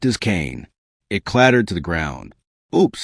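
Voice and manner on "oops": sneering. Acted. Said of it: "he sneered".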